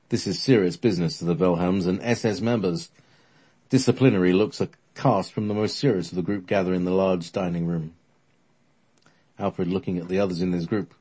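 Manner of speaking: usual speaking voice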